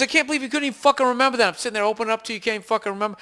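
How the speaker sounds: huffy friend scolding, a touch hammed; mad friend. Friend complaining he forgot a secret, mock angry. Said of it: "and he got mad".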